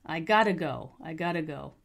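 GOTTA run together as one word; casual "gotta", no separate "to"; reduced got to. Shown as a demonstration. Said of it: "Got to becomes gotta".

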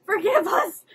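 high, loud mock begging; mock pleading minions. Imitating minions begging Cracker's forgiveness, mock pleading. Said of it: "They're like".

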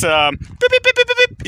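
high beep beep like a car horn; imitated car horn. Shown as a demonstration. To viewers, imitating a car horn with high beeps. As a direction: toot it like a hooting car. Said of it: "What do you call that?".

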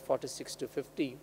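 ordinary talk

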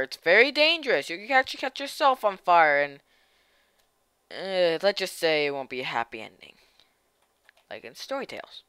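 ordinary talk

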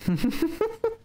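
high giggle of disbelief; high laugh. Real, not acted. Reacts to creepy line.

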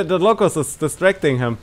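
laughing. Real, not acted. Gamer tells teammate about the Locust distracting their target, laughing. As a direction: talk through rising laughter. Amused by the little tank.

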